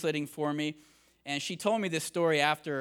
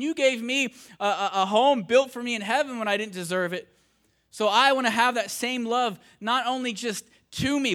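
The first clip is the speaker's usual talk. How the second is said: loud, raised, urgent sermon delivery; full-force preaching; 5 semitones higher, 5 dB louder than usual. Acted. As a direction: preach it out to the whole room, driving every line home. Preaching to the congregation.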